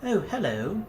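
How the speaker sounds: an English artificer looking up from his automaton; upper-class English. Acted. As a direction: greet like a plummy English inventor. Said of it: "upper-class English tones".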